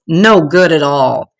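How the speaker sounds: ordinary delivery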